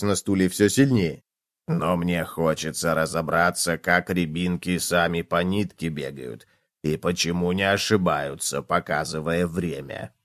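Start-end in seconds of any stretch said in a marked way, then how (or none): 1.7-10.1 low, soft, musing voice; curious cockroach. A narrator's reading. Cockroach wants to understand the watch, pensive. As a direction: puzzle aloud quietly in a deep pitch.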